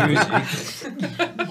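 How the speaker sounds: high, loud laughing musique. Real, not acted. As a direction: squeal it out laughing. Player laughs, imitating the song.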